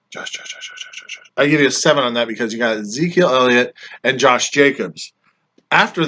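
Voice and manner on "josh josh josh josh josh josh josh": a string of hushed calls; whispered calling. Pretend. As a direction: whisper his name over and over. Whispering name while searching list.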